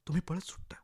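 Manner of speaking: tense whisper. A narrator's reading. Narrator telling the listener he runs, whispered. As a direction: hiss it like the moment before bolting.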